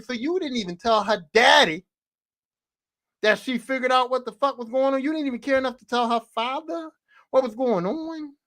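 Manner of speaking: ordinary talk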